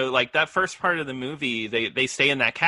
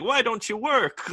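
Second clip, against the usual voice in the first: a hammed-up, higher yell at the screen; frustrated husband mock-shouting at his computer; 9 semitones higher than usual. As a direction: play him snapping at a machine that won't work. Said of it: "he's yelling at the computer".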